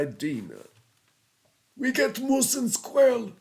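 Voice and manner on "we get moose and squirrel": higher, hammed-up cartoon Russian accent; Boris cartoon Russian accent. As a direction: play a cartoon Russian spy, thick accent. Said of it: "I'm doing Boris and Natasha".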